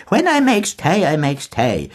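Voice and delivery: put-on high, wheedling old crone; old woman's wheedling voice. A narrator's reading. Mulligan mimicking an old woman, wheedling high. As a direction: coax it like a cunning old woman. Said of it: "in an old woman's wheedling voice".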